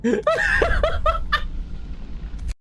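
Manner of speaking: a high, squealing burst of laughter; shrill giggle fit. Real, not acted. Burst of laughter while gaming.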